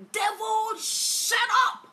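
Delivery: loud, very high scream at the devil; piercingly high, furious rebuke; full-voiced scream. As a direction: scream it at the top of your voice. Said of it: "I screamed on top of my voice".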